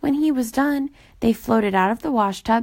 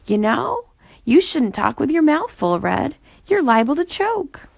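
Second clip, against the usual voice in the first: fussy, higher scolding voice; fussy Boober voice; 7 semitones higher, 3 dB louder than usual. A narrator's reading. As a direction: gently lecture a friend.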